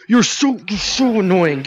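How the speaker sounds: high, loud frustrated yell at the game; exasperated yell. Real, not acted. Gamer yelling at a chasing enemy, exasperated. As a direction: shout it out in exasperation.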